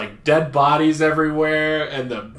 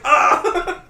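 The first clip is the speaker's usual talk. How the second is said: high short scream as he's taken out; mock scream; 13 semitones higher, 4 dB louder than usual. Guard yelling as the Nexu takes him out, high yelp. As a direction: yelp like being pounced on.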